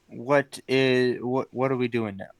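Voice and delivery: ordinary delivery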